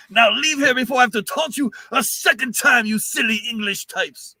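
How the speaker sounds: high, loud mock French accent; French taunter. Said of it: "great quotes in Monty Python".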